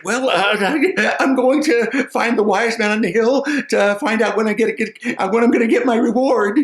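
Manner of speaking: high, loud, stammering; nervous simple man. Acted. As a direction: stammer it out, anxious. Simple man answers the wolf.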